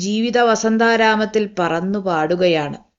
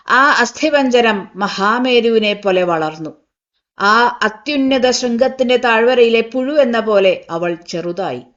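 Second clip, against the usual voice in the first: ordinary delivery, nothing marked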